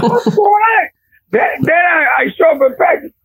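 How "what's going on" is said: high-pitched, laughing disbelief; bewildered. Retold or imitated. New coach cursed at by kids, high comic bewilderment.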